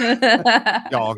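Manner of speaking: high, loud mock Swansea yogurt; imitating Swansea accent. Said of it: "your Swansea accent".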